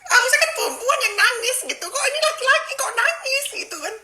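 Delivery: high giggly disbelief, laughing through the words; can't stop laughing at the memory. Real, not acted. Telling viewers the man cried, laughing high. Laughs recalling crying boyfriend.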